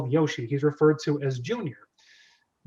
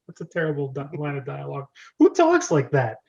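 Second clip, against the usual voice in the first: usual speaking voice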